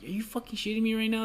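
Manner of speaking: high, incredulous inner outburst; disbelief. Retold or imitated. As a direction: pitch jumps up in disbelief. Inner disbelief at rude guest.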